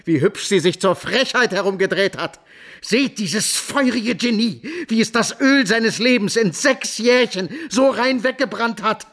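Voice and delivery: twist the praise into scorn; mocking sneer. Franz mocks his brother to father. (höhnisch, spöttisch überhöht)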